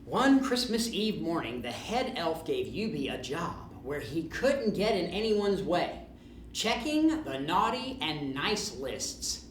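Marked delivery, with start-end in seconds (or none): none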